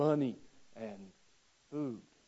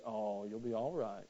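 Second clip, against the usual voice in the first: soothing mother; 5 semitones lower, 8 dB quieter than usual. Retold or imitated. Mother soothing hurt child.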